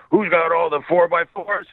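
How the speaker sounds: high, slow drill-sergeant call; sing-say it like a sergeant calling cadence. Retold or imitated. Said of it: "I was the sergeant".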